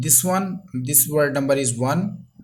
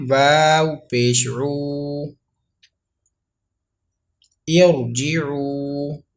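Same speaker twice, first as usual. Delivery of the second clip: slow spelling drill; much slower than usual. Shown as a demonstration. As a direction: spell each letter slowly for pupils to repeat. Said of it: "So revise after me".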